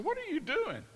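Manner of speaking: high-pitched mock protest; incredulous. Diner questioning friend's big tip, incredulous.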